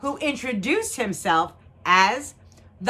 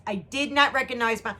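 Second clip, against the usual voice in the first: usual speaking voice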